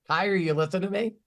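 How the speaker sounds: normal talking voice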